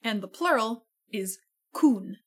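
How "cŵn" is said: "cún" with a pure oo, like the end of raccoon; Welsh pronunciation demo. Shown as a demonstration. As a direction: model "cún" as koon, oo held pure. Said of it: "Pronounced like the tail end of raccoon".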